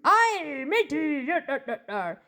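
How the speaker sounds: playful pirate cry falling into yars; hammed-up seadog greeting. Pirate on his eightieth birthday, jokey pirate voice. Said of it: "What did the pirate say".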